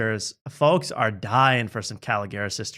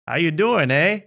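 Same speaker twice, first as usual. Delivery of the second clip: lightly mocked Canadian accent, a touch higher; 4 semitones higher, 3 dB louder than usual. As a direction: toss off a lame Canadian "eh". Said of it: "the old stereotypical lame".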